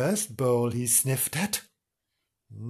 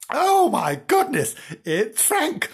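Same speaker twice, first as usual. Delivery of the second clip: high, slow "oh my goodness"; startled exclamation; 10 semitones higher, slower than usual. A narrator's reading. Dog woken by a howl next door, startled high exclamation. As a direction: exclaim it high in mild alarm. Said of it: "said Bimbo to himself".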